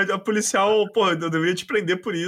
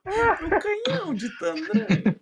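villain voice; 11 semitones higher, much slower than usual. Retold or imitated. Cartoon villain imitation.

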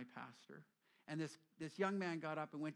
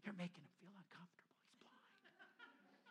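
whisper; 3 semitones higher, 14 dB quieter than usual. Retold or imitated. Whispered aside to Jesus.

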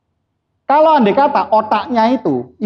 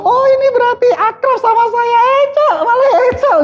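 much higher, squeaky buddy voice calling out; mock friendly banter; 4 dB louder than usual. Speaker playing a friend who swears affectionately, high mock chirp. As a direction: chirp it like a pal greeting a mate. Mimicking a friend's playful greeting.

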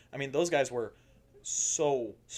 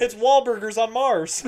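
laughing; 11 semitones higher, 13 dB louder, slower than usual. Real, not acted. Laughing joke.

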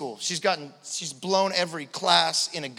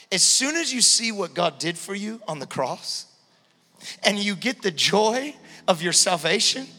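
high, impassioned pulpit delivery; full-force preaching; 9 semitones higher, 6 dB louder than usual. Real, not acted. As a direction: drive the message home, stressing key words.

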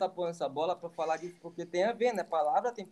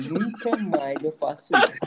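laughing retold vow; 4 dB louder than usual. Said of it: "O desespero da Breno".

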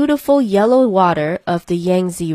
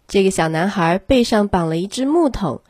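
ordinary delivery, nothing marked

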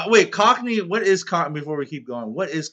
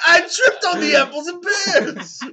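mock dismay; 8 dB louder than usual. Player pretends to have tripped on stairs, mock dismay.

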